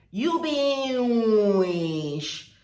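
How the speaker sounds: very long, loud "witch" said as "weeesh"; Warri pronunciation. Shown as a demonstration. Demonstrating the Warri insult, loud and hugely drawn out. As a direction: bellow "You be witch" stretched to the limit. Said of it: "this is how they pronounce it".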